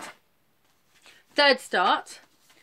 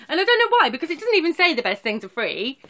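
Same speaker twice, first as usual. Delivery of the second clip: amused outburst; 8 semitones higher, 8 dB louder than usual. Real, not acted. Amused outburst about the lyrics.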